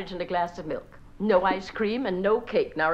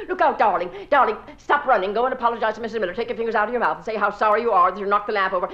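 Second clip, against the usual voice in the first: loud, fast flustered scolding; 6 dB louder, much faster than usual. Acted. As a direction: hurry him to apologize. Flustered mother scolds son.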